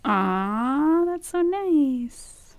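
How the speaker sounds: slow, touched and moved. Real, not acted. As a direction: melt at the compliment. Touched by sweet words.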